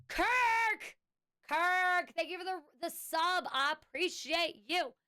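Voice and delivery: high-pitched, playful shout-out; showy thanks. Pretend. Streamer thanking Kirk for the sub, high and showy. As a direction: sing out the gratitude in a high voice.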